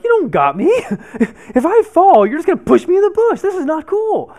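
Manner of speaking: a very high, loud, squealing protest that climbs as it goes; hammed-up terror of falling into the bush; panicked protest. Stuck on the rock, yelling down at his friend, shrill panic. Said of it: "really starting to freak out".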